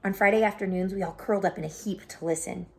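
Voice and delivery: ordinary delivery, nothing marked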